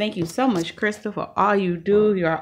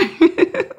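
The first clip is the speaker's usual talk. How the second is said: amused laugh; 9 semitones higher than usual. Real, not acted. Laughs after imitation.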